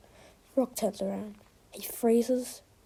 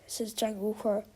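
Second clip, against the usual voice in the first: ordinary delivery, nothing marked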